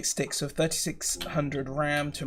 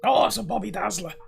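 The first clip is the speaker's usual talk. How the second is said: Dave Jones catchphrase; 5 semitones higher than usual. Retold or imitated. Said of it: "Dave Jones has got really good catchphrases".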